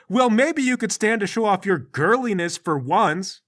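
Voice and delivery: slow, high-pitched jab; taunting retort. Acted. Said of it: "And he goes".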